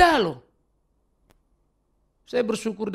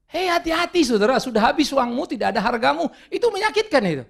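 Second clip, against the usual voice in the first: raised, pressing warning to the congregation; fiery preaching; 6 semitones higher, 3 dB louder than usual. Real, not acted. Preacher warning the congregation against buying status, fiery. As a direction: press the warning home hard.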